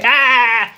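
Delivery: startled mock cry. Telling the myth, he breaks off with a startled yelp. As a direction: cry out as if struck by the sight.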